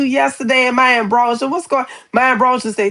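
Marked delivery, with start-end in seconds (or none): none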